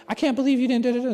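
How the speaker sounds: high, loud mock rant; upset wife. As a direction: imitate a wife going off at her husband. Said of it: "she's like".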